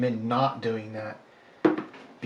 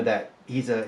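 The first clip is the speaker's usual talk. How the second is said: usual speaking voice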